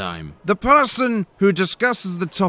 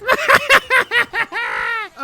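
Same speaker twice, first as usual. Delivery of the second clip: a shrill surprised giggle breaking out; high giggling burst. Real, not acted. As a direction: burst into a high laugh at the reveal. High laugh at the reveal.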